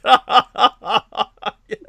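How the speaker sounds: high, loud rising laugh; hearty laugh. Real, not acted. Host laughing at his joke about his helper's forehead. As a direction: crack up at your own joke.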